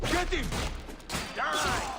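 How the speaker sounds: loud, high fighting yell; yelling mid-ambush at the attackers; battle shout. Acted. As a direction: roar them down in the fight.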